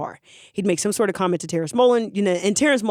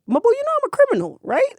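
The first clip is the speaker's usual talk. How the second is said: much higher and fast, in disbelief; incredulous; faster than usual. Retold or imitated. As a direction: squeak out the shock. Lewis incredulous at roper offer.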